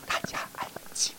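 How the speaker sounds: teacher whispering to quiet the class. Shown as a demonstration. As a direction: call for silence by whispering. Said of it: "我们的做法哈就是这样子".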